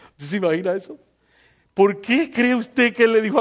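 ordinary delivery

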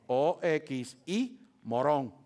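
O X I spelled slowly, then morón; spelling it out. Shown as a demonstration. Pastor spelling a new word for the congregation, slow. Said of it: "La palabra oximorón".

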